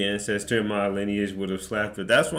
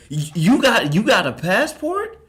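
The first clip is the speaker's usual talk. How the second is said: loud, high, stammered mock astonishment; hammed-up incredulous question; shocked disbelief; 11 semitones higher, 9 dB louder than usual. Clerk at the glass gawking at a passport, shocked. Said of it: "you shoulda saw her face".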